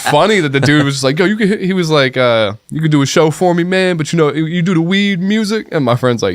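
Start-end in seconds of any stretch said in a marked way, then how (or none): 1.2-5.7 high, exaggerated put-on voice of a prank caller. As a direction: ham up a fake street voice.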